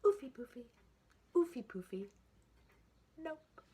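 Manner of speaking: high, squealy piglet voice, defiant; piggy voice. A narrator's reading. The piggy answering her family, high piggy voice. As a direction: sing it out like a stubborn little pig. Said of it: "the piggy answered".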